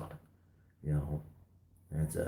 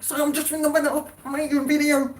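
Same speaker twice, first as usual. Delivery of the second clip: squeaky embarrassed excuse; high mock self-voice; much faster than usual. Caught filming, explaining to the neighbours in a squeaky hurried voice. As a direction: imitate yourself making a fumbling excuse, high and fast. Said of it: "It's like".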